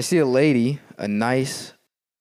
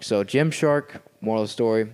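ordinary delivery

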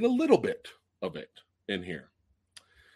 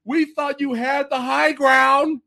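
high, slow shouted lament; hammed-up movie quote; mock Obi-Wan anguish; 13 semitones higher, 6 dB louder, slower than usual. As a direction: drag out the betrayed cry. Quotes Star Wars line.

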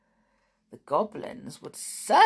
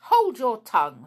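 loud, high quarrelling command; angry order; 5 semitones higher, 11 dB louder than usual. A narrator's reading. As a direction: cut the other goblin off loudly. Goblin orders another in quarrel.

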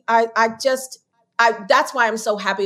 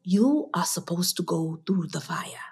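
whispered, lower imitation of a Ghanaian king's accent; bad Ghanaian accent; 4 semitones lower, 8 dB quieter than usual. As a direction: breathe the Ghanaian king's words in a hushed, clumsy accent. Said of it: "this is a bad accent".

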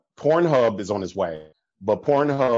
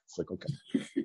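whispered deadpan; 3 semitones lower, 15 dB quieter than usual. Real, not acted. Deadpan reaction, unvoiced.

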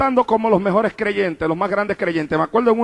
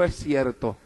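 low, hushed imitation of a confession; retold tearful admission; 9 semitones lower, 9 dB quieter, slower than usual. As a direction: let it sink softly, lightly imitating her. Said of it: "ella lloró y me dijo". (confesión llorosa imitada, en voz baja)

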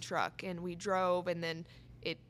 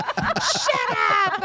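retold shout; 12 semitones higher, 10 dB louder than usual. Wife snaps at teasing friend, loud high shout. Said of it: "I lost it".

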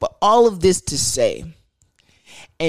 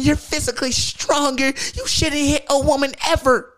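mocking feminist voice; 10 semitones higher than usual. Mocking the online critics who would scold him, in a high preachy voice. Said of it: "quote unquote feminists would have hopped in my".

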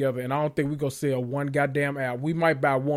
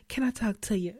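put-on baby doll voice; 6 semitones higher, 6 dB quieter than usual. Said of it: "she do that little baby doll voice".

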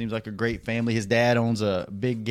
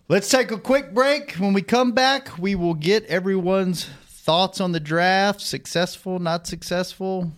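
announcer voice; 5 semitones higher than usual. Acted. Radio segue.

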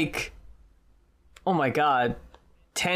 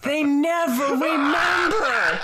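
exasperated; 7 semitones higher than usual. Real, not acted. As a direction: throw up your hands about it. Exasperated about forgotten wrongs.

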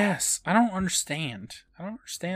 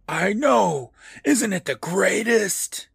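higher, gleeful creepy voice; mask voice; 7 semitones higher, 3 dB louder, slower than usual. Retold or imitated. Mask gloating to Chuck, high and gleeful. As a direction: delight in it like a ghoul. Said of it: "how he's doing that voice".

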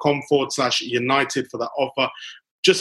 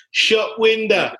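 high, slow drawn-out Harry Redknapp; Harry Redknapp impression; 8 semitones higher, much slower than usual. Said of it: "as Harry Redknapp would once say".

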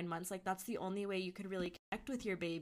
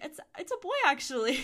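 bright, high and loud with delight; imitating the midwife's happy announcement; super happy; 8 semitones higher, 8 dB louder, faster than usual. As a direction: announce it gleefully. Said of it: "she was super happy".

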